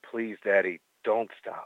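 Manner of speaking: low sultry murmur. Pretend. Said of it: "Or, or say".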